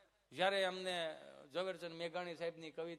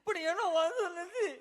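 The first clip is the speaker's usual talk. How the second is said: high, loud, slow blubbering with a laugh; hammed-up weeping wail; mock sob; 10 semitones higher, 11 dB louder than usual. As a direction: cry it out in mock despair. Patient crying to doctor.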